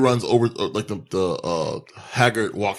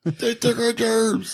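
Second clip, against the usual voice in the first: put-on voice; 13 semitones higher, slower than usual. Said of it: "repeated joke line, varied".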